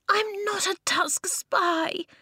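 cried out. A narrator's reading. Eska denying she is a spy, crying out. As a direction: protest it desperately. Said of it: "Eska cried".

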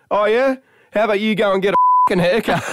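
mock snapping back. Ditz snapping at the young guest, mock retort. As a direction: fire it back quick. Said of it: "Ditz eyeballs me, Loz, and goes".

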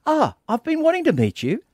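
high, eager, starstruck gushing; gushing fan. Retold or imitated. Retelling how he gushed at a famous woman, high and eager. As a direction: gush over her like a starstruck fan.

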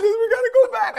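very high, tearful imitation with laughter in it; mock hysterical. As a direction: wail it like a panicked wife, played for laughs. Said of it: "she's hysterical".

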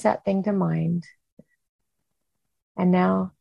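slow guided breathing with long pauses; slow breath prayer. Acted. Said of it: "leading us in kind of that breathing".

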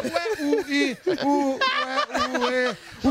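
high, stammering bafflement; mock confusion. Comedian imitates the confused police chief, baffled stammering. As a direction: stumble over little words as if baffled. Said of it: "Sabe confusão mental?".

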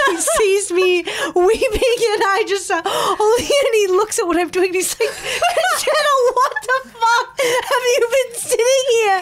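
high, squealing, tearful laughter through the words; laugh-crying, voice way up high; overcome with emotion. Real, not acted. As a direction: squeak it out between laughing and weeping. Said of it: "feel these huge waves of emotion".

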